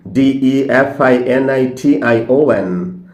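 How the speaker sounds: slow letter-by-letter spelling; ডিইএফআইএনআইটিআইওএন spelled slowly, one letter at a time; spelling aloud. Shown as a demonstration. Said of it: "স্পেলিংটা জেনে নাও".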